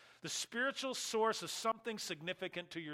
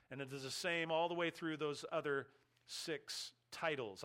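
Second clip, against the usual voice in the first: ordinary talk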